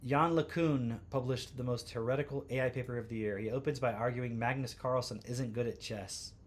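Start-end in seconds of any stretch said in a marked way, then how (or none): none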